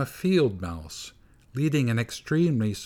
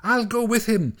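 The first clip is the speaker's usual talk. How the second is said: frantic mother mouse; 11 semitones higher than usual. A narrator's reading. Mother mouse insists on following her baby into the cello, high frantic voice. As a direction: leap after her baby in a panic. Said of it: "said the mother mouse frantically".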